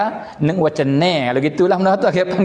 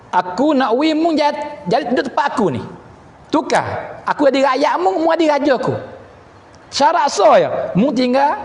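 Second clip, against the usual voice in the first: higher-pitched king pitching a bargain; acted Roman king; 6 semitones higher than usual. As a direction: press the captive with the king's offer, voice raised in pitch. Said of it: "Maka Raja Rom kata".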